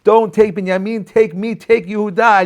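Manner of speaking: high, loud desperate plea; pleading cry. Retold or imitated. Yehuda begging the viceroy to spare Binyamin, loud desperate plea. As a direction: beg to take his place instead of Binyamin. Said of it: "He's like".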